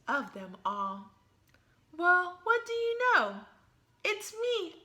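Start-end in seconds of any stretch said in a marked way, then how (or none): 2.0-4.7 higher and louder, thrilled to be picked; delighted surprise. Pretend. Fairy hears the mirror name her, delighted surprise. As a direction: squeal it like winning a prize.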